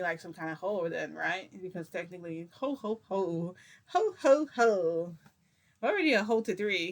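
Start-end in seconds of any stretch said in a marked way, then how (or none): 2.5-5.1 mock ho-ho-ho. Joking to viewers about the word, mock chant. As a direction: play with each slow ho.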